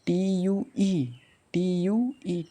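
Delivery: slow, deliberate letter by letter; slow spelling. Shown as a demonstration. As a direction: spell each letter out slowly. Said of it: "यानी ट्यू". (धीरे-धीरे अक्षर बोलना)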